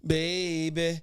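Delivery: slow, swelling smooth-talker croon, loud at the end; Mister Smooth. Retold or imitated. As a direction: lay it on like a smooth-talking suitor. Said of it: "You had to be a Mister Smooth".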